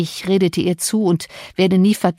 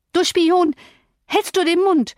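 high-pitched outraged exclamation; indignant, high-voiced command to hush; angry cry; 11 semitones higher than usual. A narrator's reading. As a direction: cry out at the tattletale, pitch shooting up. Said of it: "schrie Catherine".

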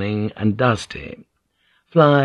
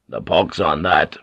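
low-pitched, dismissive sailor; gruff refusal; 5 semitones lower than usual. A narrator's reading. Sailor denying the captive water, gruff. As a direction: drop the pitch and refuse curtly.

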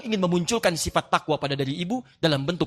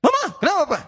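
mock wife's shrill question; 9 semitones higher, faster than usual. Wife snapping at her husband in a mock quarrel. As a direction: squeal it like an annoyed wife.

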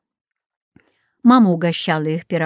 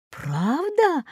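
surprised; 7 semitones higher, 5 dB quieter than usual. A narrator's reading. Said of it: "удивился гость".

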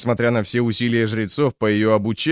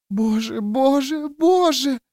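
put-on panicked child prayer; slower than usual. A narrator's reading. Girl prays in panic.

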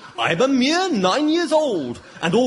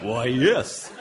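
theatrical; 10 semitones lower than usual. Acted. Theatrical Shakespeare.

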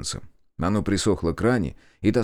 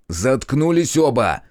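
loud, slow, higher bark to shut them up; barked order; 6 semitones higher, 5 dB louder, slower than usual. Acted. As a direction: cut the squabble dead. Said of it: "рявкнул я". (рявкнуть громко и медленно)

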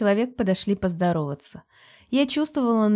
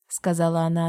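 ordinary delivery

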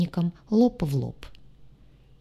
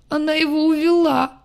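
sobbing wail; 9 semitones higher, 4 dB louder than usual. Acted. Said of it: "разрыдалась".

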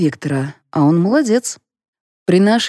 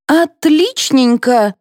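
high, slow sarcastic drawl; drawn out; 8 semitones higher, 3 dB louder, much slower than usual. A narrator's reading. Mother reacting to the family decision, drawn out. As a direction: stretch the word out, pitched up. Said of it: "протянула мать Марфы".

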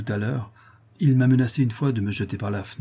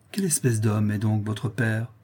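ordinary delivery, nothing marked

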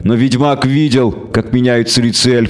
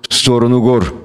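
usual speaking voice

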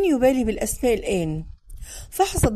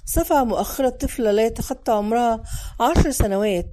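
ordinary delivery

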